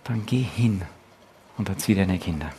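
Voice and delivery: soft, hushed "Dann geh hin", words breathed close to the ear; gently hushed fatherly voice whispering "erzieh deine Kinder"; whispered into the ear. Retold or imitated. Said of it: "dann flüstert er uns ins Ohr".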